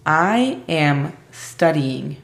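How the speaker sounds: very slow, deliberate English; slow flashcard prompt. Shown as a demonstration. Teacher quizzing Aimee with flashcards, slow. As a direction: read the card out slowly. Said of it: "Ronda número uno".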